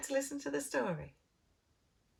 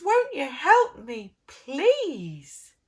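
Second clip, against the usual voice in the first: louder pleading piggy plea; 3 semitones higher, 11 dB louder, much slower than usual. Acted. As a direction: beg for help like a stuck pig. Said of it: "He says".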